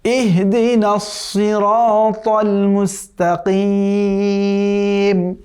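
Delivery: slow, melodic tajweed chant drawn out at the end; chanted Quranic recitation. Shown as a demonstration. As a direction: recite the verse once, slowly, with full tajweed.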